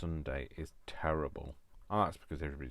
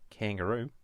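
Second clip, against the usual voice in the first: mock Australian accent, higher, rising at the end; 5 semitones higher, 5 dB louder than usual. Crafter offering Australian viewers her version, amateur Australian accent. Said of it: "my version of your accent".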